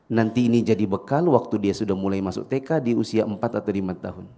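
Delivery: usual speaking voice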